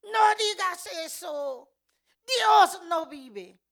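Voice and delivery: loud, high, drawn-out angry shout; angry retort. Retold or imitated. Someone rebuking her faith in God, angry shout. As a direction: shout it in outrage, stretching the words. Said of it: "se enojó tanto y me dice".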